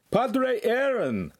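high, drawn-out shout; man shouting. A narrator's reading. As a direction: call it out long and high. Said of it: "a man's voice shouted".

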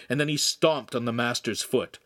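ordinary delivery, nothing marked

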